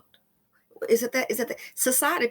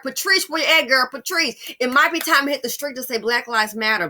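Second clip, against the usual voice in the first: loud, raised call to Patrice; 4 semitones higher, 7 dB louder than usual. Real, not acted. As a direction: shout across to Patrice. Calling out to Patrice.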